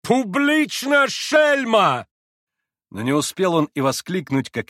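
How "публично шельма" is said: very high, drawn-out yell; high, enraged cry; furious shout. A narrator's reading. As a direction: scream the insult, stretched out. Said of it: "закричал".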